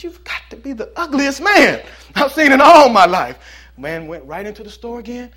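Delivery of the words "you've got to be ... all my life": high, loud, mocking parrot yell; loud bird insult. Parrot shouting at the ugly man again, loud and mocking.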